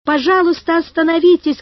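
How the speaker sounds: urgent high-pitched appeal; shouting plea. A narrator's reading. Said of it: "кричал Карик".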